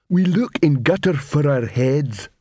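slow troll dryness; dim troll voice. Acted. Detritus to Cuddy, slow deadpan troll voice. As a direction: state the grim outcome plainly.

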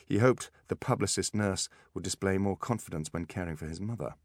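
plain reading voice

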